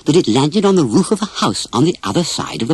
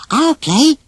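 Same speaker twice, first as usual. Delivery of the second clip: put-on high, eager young elephant voice; a much higher, keen little voice offering to join; Dumbo; 13 semitones higher than usual. Dumbo offers to play with the boys, high and eager. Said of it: "offered Dumbo".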